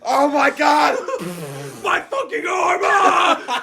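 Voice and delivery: very high, hammed-up screaming; mock crash victim. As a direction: shriek in fake agony, near laughter.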